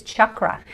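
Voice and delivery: "chakra" as CHUK-ra, short first vowel; actual Sanskrit pronunciation. Shown as a demonstration. Guest gives host the Sanskrit original, CHUK-ra "chakra". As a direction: say "chakra" with hard ch and uh. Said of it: "actual pronunciation just from Sanskrit".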